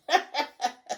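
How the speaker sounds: high, gleeful cackle; high-pitched villainous laugh; gloating cackle. A narrator's reading. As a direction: cackle with spiteful delight. Shrieking woman gloats over the caning.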